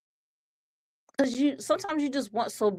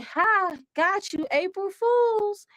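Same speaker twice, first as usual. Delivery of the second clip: much higher gleeful cartoon voice, slow at the end; SpongeBob; 11 semitones higher, slower than usual. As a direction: crow the prank's reveal in a squeaky toon voice. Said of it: "SpongeBob was like".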